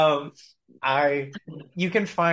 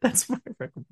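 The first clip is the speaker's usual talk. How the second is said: laughing; 12 semitones higher, 3 dB quieter, much faster than usual. Real, not acted. Laughing through her own recommendation.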